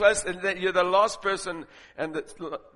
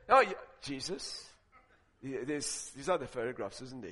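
much softer, lower sheepish question; 7 semitones lower, 10 dB quieter than usual. Retold or imitated. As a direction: ask it guiltily under your breath. Sheepish soul asking Jesus.

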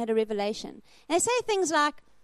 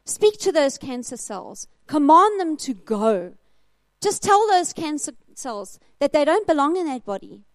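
emphatic preaching exhortation; 3 semitones higher, 6 dB louder than usual. Retold or imitated. Preacher urges a friend to command the cancer, emphatic exhortation. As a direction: urge it forcefully, stretching the key words.

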